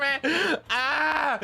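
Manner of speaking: high strangled cry of embarrassment; embarrassed cry. Retold or imitated. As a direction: let out a pained, cringing yelp. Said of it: "I was, like, screaming".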